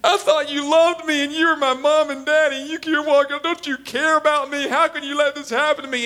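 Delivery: hammed-up guilt trip at the parents; riled-up kid. Kid to parent walking away, wounded pleading. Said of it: "when you're all riled up".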